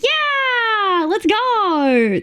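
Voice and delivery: high, bright whoop of a cheer; excited cheer. Real, not acted. Streamer cheering on a viewer's Minecraft monkeys, high excited whoop.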